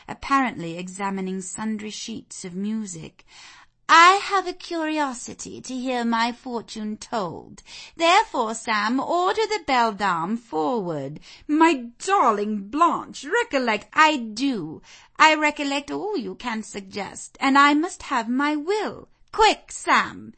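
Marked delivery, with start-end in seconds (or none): 3.9-11.2 put-on high, loud imperious young lady; haughty Blanche, commanding. 11.5-13.9 high, slow motherly remonstrance; pleading protest. Acted. Mother protests to headstrong daughter. 13.9-20.3 put-on high, loud wilful young lady; haughty Blanche, insistent. Blanche overrules her mother.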